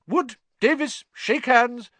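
high, loud, brisk referee voice; brisk Madam Hooch. Acted. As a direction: snap out a curt order. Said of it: "Madam Hooch said briskly".

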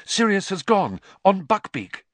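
breathless. A narrator's reading. Harry reports the escape, breathless. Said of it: "said Harry breathlessly".

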